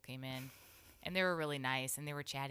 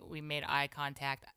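ordinary delivery

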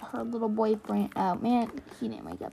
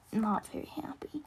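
whispering; 9 dB quieter than usual. Real, not acted. Whispered aside about Dad.

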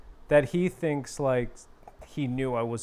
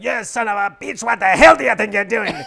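yelled fake Australian accent, high and loud; an irate Aussie bellowing at another driver; Blackball; 8 semitones higher, 11 dB louder than usual. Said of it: "I was yelling at him".

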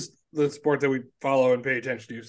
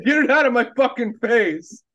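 high, loud snobbish sneer; snobby disgusted shout; 8 semitones higher, 5 dB louder than usual. Retold or imitated. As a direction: spit it out like a spoiled snob. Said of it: "try it again".

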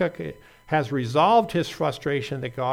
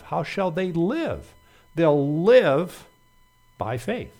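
LIVE hit loud and drawn out, "by faith" settling back; stress on LIVE; 4 semitones higher, 6 dB louder, slower than usual. Shown as a demonstration. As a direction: hammer the word "live", then pause before "by faith". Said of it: "The emphasis is on the words".

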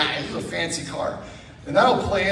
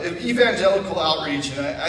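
normal talking voice

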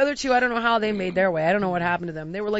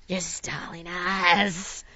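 lower, very slow, stretched words; slow drawn-out accusation; 5 semitones lower, 4 dB quieter, much slower than usual. Retold or imitated. Traveller repeating it to the crew, slow lowered accusation. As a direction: draw out every word in mock outrage.

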